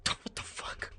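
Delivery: breathy stunned whisper under a laugh; hushed what the fuck; whispered disbelief. Real, not acted. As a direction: mutter it in disbelief, almost silent. Disbelief after quote.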